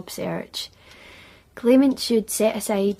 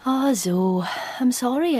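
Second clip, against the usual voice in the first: ordinary talk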